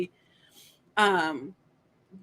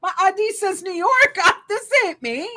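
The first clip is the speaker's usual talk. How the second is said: pitched up, pleading mock protest, slowing on I promise; flustered denial; 8 semitones higher, 3 dB louder than usual. To fans sending the article, high flustered mock denial. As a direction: insist it's not you, half laughing. Denying being the stalker.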